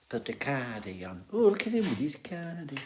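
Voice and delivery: put-on light northern English accent, said with a chuckle; Coronation Street accent. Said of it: "I don't know why I do accents".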